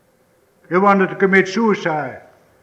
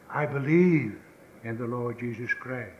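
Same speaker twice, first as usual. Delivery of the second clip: hushed confession; 10 semitones lower, 14 dB quieter than usual. Real, not acted.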